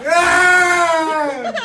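high hammed-up wailing shout; hammed-up high cries about polar bears; mock wailing. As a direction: wail like a distraught kid, slowly. Mocking crying Greta.

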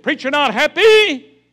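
put-on high, loud, slow accented voice; African man's question. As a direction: mimic the big African man, slow and loud. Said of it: "one of the big African men walked over to me and said".

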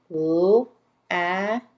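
"L-a-p-t-o-p" one isolated sound at a time; long gaps between the sounds of "L-a-p-t-o-p". Shown as a demonstration. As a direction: tap through "L-a-p-t-o-p" sound by sound.

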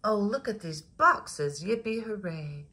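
high, loud delighted cheer; excited kids. A narrator's reading. Children cheer at finding boxes, thrilled. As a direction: squeal with joy over the boxes.

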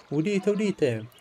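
high, slow crowd cry of approval; town crier. Acted. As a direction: call it out like a villager cheering. Crowd voice cheering the speaker.

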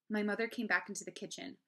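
plain reading voice

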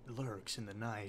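low, soft trailer narrator; deep ominous trailer murmur; horror trailer voice. Pretend. Mock movie trailer narration.

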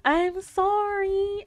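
drawn-out sorry. Real, not acted. Apologizes after banning mistake.

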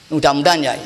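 usual speaking voice

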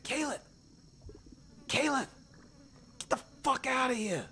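high, shrill panicked goblin shout; frantic goblin yell. Acted. Goblin yelling at Caleb to get out. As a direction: yell across the water in a squeaky voice.

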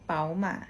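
"เป๋าหม่า" said as Mandarin bao-ma with rising tones; "เป๋าหม่า" in Chinese tones, not Thai; Mandarin reading. Shown as a demonstration. Teacher showing the Chinese name for BMW, Mandarin tones. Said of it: "คนจีนอ่านว่า".

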